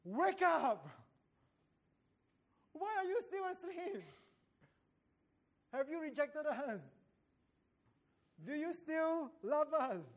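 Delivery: anguished plea, voice up high; crying out to God. Acted. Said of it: "to cry out".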